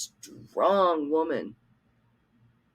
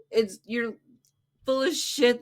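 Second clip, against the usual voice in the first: laughing; 7 semitones higher than usual. Real, not acted. Laughing while insulting streamer.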